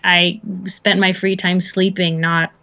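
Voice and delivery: ordinary talk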